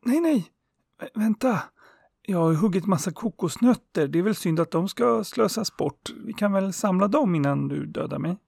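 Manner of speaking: trapped man pleading desperately with the sun; desperate plea. Acted. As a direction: beg for your life, voice shooting up. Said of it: "ropade mannen desperat".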